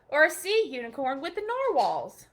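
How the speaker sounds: ordinary delivery, nothing marked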